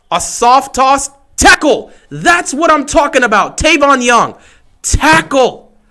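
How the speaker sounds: high, slow call rising to a cheer; excited cheer. Real, not acted. Ravens fan cheering a stop on third down.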